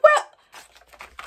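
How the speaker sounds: mock baffled Stu. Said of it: "And Stu was like".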